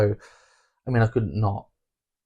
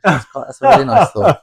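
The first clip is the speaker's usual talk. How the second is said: much higher and louder, real emotion welling up; getting emotional; 11 semitones higher, 12 dB louder than usual. Real, not acted. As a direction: let it rise as you get moved. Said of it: "I'm getting a bit emotional".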